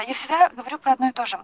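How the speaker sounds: ordinary delivery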